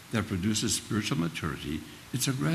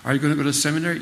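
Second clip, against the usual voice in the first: higher, louder questioning adult; quizzing teacher; 6 semitones higher, 6 dB louder, much faster than usual. Retold or imitated. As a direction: press the boy with the question. Retold teacher quizzing Jimmy.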